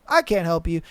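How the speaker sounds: usual speaking voice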